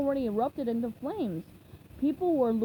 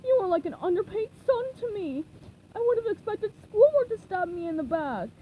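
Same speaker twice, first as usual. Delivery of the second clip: higher, louder, hammed-up betrayal; wounded lament; 8 semitones higher, 4 dB louder than usual. A narrator's reading. Mr. Krabs laments SpongeBob's betrayal, hammed-up wounded voice. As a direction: overplay the hurt boss.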